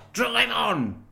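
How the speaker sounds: barked command. A narrator's reading. Witch queen orders the dwarf to drive on, barking. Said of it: "said the witch".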